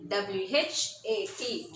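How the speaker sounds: W H A T spelt slowly letter by letter; spelling out letters. Shown as a demonstration. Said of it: "You must pronounce after me".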